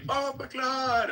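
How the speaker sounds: very high, drawn-out mock yell; imitated random yell. Imitating a Jamaican teammate in Helldivers, drawn-out high yell. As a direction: stretch it high like a gamer's yell. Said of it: "randomly yelling".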